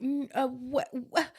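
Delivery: hesitant stammering imitation of Karina; mock stammer. Retelling Karina caught out, stammering hesitantly. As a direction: stumble for words, caught out.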